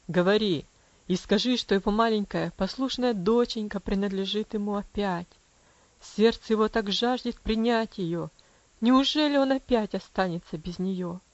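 low, tender father's voice. Acted. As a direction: coax her gently. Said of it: "тихим, полным нежности голосом". (нежно, низким голосом)

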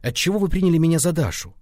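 derisive, lifted voice; mocking. A narrator's reading. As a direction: tease him as a scornful ghost. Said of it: "насмешливым голосом привидение".

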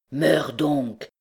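ordinary delivery, nothing marked

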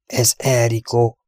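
slow, hushed horror; horrified whisper. A narrator's reading. Steve recognising the horse's tracks, hushed and horrified. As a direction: say it slowly, with understated dread. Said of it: "suttogta megborzadva".

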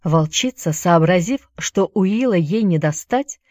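ordinary delivery, nothing marked

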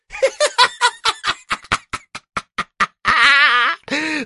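high, cackling devil laugh; shaking laugh. Acted. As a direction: cackle in little shaking bursts. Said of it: "мелко затрясся от хохота".

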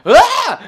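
high, loud hammed-up fan scream; squealing mock fan scream; mock fangirl scream. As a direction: shriek like an overexcited teen fan. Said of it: "And then I kept doing all".

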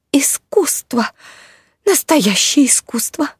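high, moved spider lady voice; touched sigh. A narrator's reading. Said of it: "умилительно вздохнула леди Кружева".

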